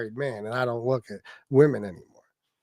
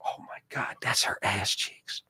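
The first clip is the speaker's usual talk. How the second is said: hushed shocked whisper; 8 semitones lower, 10 dB quieter, slower than usual. Retold or imitated. Guy ogling jeans whispers in shock. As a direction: breathe it like a secret. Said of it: "you'd be like".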